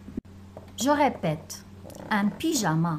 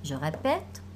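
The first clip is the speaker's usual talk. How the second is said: ordinary delivery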